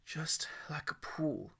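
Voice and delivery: soft, slow whisper to himself; self-calming whisper. Acted. Boy whispering courage to himself before jumping. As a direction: whisper to steady yourself. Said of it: "he said, leaning into".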